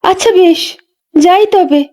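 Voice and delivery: put-on high, light young woman's voice; a pregnant young wife, lively and a bit weary; young village wife. Young Ganga tells the old midwife about the far pond, in a high young voice.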